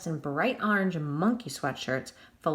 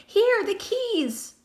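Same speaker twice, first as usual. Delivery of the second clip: much higher, louder, slow desperate shout; panicked shout; 13 semitones higher, 6 dB louder, much slower than usual. A narrator's reading. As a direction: fling them out in panic.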